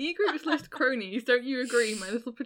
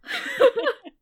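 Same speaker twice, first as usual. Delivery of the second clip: loud high shriek of laughter; high squealing laugh; 8 dB louder than usual. Real, not acted. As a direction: let a shrill laugh burst out. Cracking up after reading a line.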